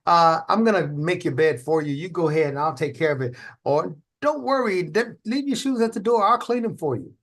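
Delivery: high-pitched, eager-to-serve helper; extremely happy people-pleaser. Acted. Offering coat, coffee and tea to someone, extremely happy. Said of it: "people out there like that who are extremely happy".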